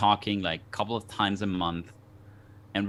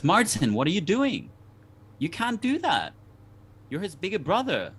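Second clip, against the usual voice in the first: higher-pitched retold scolding from mom; 9 semitones higher, 3 dB louder than usual. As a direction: scold the little boy like an upset mother, pitch pushed up. Mom scolding young Martin.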